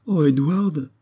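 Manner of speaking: high pleading exclamation; crying out. A narrator's reading. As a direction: cry out his name in distress. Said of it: "s'écria-t-elle".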